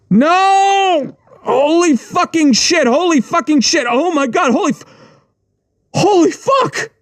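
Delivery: shrill panicked yelling; screaming in pain. Acted. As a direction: howl at the shock of being shot. Narrator just shot in foot.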